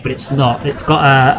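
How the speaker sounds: ordinary talk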